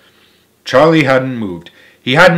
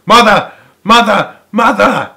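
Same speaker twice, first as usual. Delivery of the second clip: loud, high calls repeated; shouting; 9 semitones higher, 7 dB louder than usual. A narrator's reading. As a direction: call for mother across the house.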